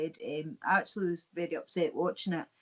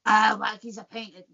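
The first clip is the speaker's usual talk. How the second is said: put-on a failed stab at an Irish accent; attempted Irish accent; 6 dB louder than usual. Irishman in the joke orders a pint, botched Irish accent. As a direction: try an Irish accent and give up. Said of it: "I can't do the Irish accent".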